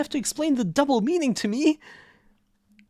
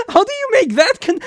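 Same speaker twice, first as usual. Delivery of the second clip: incredulous; 7 semitones higher, 8 dB louder than usual. Real, not acted. Reacting in disbelief to joke.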